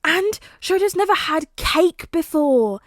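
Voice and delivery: put-on high, slow, loud child voice, indignant; a small boy shocked on his friend's behalf; outraged little boy. A narrator's reading. Son tells father his friend never had cake, childish outrage.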